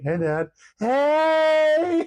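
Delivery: put-on bubbly teen greeting at full pitch; daughter's squeal greeting. Dad imitating his grown daughter's high excited greeting. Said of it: "she'll be like".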